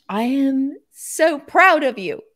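crying out loud, high and choked; real tears of pride breaking through; tearful pride. Real, not acted. Host overcome with pride, tearful.

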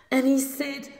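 plain reading voice

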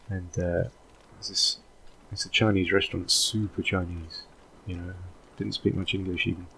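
normal talking voice

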